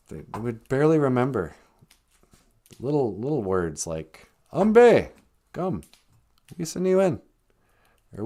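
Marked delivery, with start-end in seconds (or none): 4.5-7.2 lightly voiced grandma calling kids to eat; a hint of an old grandma calling out "Ambe". Grandson imitating grandma calling the kids to eat. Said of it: "Grandma always said that".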